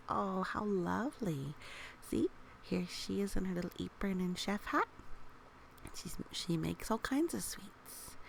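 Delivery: ordinary delivery, nothing marked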